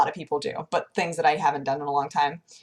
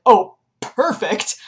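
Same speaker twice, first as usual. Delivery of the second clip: relieved perfect; 3 semitones higher, 6 dB louder, slower than usual. Retold or imitated. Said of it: "And I was like".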